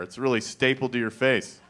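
ordinary talk, nothing marked

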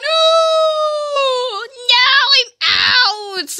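long, high, very loud wail of defeat; anguished shout. Real, not acted. Player knocked out of the game, loud high wail. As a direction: cry out loudly, dragging out the words.

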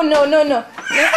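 normal talking voice

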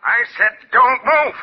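slow, high-pitched repeated order; deliberate, drawn-out gunman's command; insistent warning. Acted. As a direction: spell out the threat slowly.

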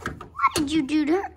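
high, loud accusing question; alarmed demand. Acted. Confronting someone over what was done to her, loud alarm. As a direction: burst out in alarm.

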